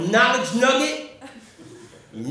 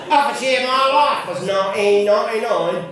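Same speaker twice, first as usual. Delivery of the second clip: mock Cockney with swallowed T's; Cockney, dropping every T sound; London Cockney accent; 2 semitones higher, 4 dB louder than usual. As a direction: put on a broad London Cockney. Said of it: "I like the London Cockney accent".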